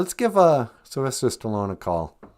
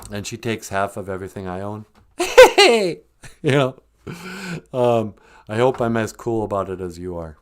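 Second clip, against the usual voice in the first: normal talking voice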